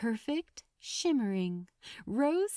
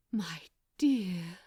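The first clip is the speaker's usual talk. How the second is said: breathed; 4 semitones lower than usual. Acted. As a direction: breathe the words out, full of longing. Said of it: "breathed Aziraphale".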